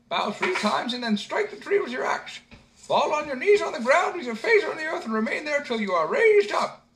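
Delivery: put-on very high, loud squeaky old man; a tiny old man giving eager orders; old man instructing the simpleton; little old man. A narrator's reading.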